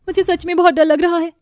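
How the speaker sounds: anxious, high-pitched confession; acted nervous fear; frightened. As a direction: tell them in a high, scared voice. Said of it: "Neha बड़ी घबराई थी".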